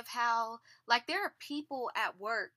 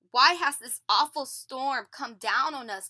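demanding; 2 semitones higher, 8 dB louder than usual. A narrator's reading. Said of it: "they demanded".

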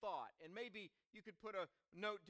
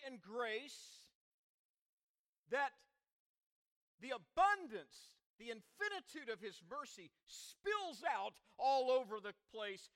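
loud, raised-pitch sermon delivery; emphatic preaching; 4 semitones higher, 7 dB louder than usual. Real, not acted. Preacher on the gift to the entire earth, emphatic and loud. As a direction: drive each phrase home with pauses between.

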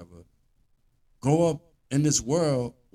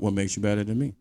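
ordinary delivery

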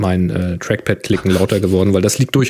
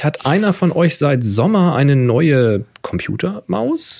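reading aloud; 7 semitones higher than usual. Acted. Reads listener question aloud.

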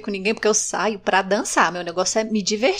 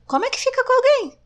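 exasperated; 14 semitones higher, faster than usual. Real, not acted. Exasperated rhetorical question.

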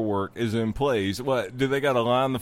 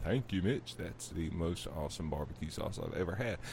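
low, very soft mock thanks; grateful customer; 9 semitones lower, 13 dB quieter than usual. Imagined listener thanking the host for sauce, low soft mock gratitude. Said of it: "You will be like".

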